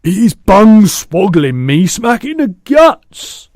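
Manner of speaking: high, slow, very loud shout; giant's shout. A narrator's reading. Kicked giant wakes up complaining, high slow shout. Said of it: "shouted the gizzard gulper".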